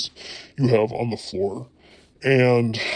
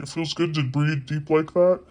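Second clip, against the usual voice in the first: ordinary talk, nothing marked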